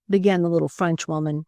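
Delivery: ordinary delivery, nothing marked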